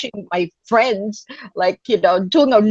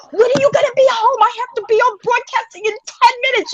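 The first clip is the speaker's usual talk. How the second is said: high, frantic pleading to sister; urgent and desperate, pitched way up; frantic call asking when she'll be home; panicked phone call; 12 semitones higher than usual. Retold or imitated.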